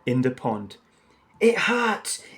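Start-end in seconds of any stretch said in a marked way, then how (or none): 1.4-2.2 much higher, louder pained howl. Acted. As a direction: howl out the hurt. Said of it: "howled Horace".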